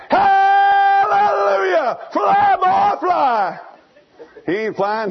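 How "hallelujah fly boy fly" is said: high, loud, slow hallelujah; shouting mother. Retold or imitated. Mother eagle shouting at her falling son, loud and drawn out. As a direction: shout him into flight. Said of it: "She shouts".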